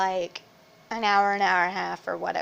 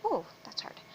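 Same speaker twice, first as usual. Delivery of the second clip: whispered with a sore throat; whisper, voice giving out; losing voice; 14 semitones higher, 12 dB quieter than usual. Real, not acted. Sick vlogger shows her voice going, whispered. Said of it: "from talking like this to talking like this".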